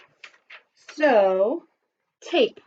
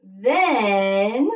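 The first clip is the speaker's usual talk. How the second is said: model sentence; much slower than usual. Shown as a demonstration. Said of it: "Then you're going to write".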